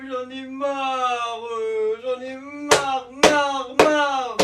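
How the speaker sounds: high, very slow hammed-up moaning; mock wailing.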